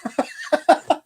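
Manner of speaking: bursts into a high, airy laugh; a real laugh that jumps up high; high-pitched laugh. Real, not acted. Laughing at the sergeant's heart attack story, high laugh.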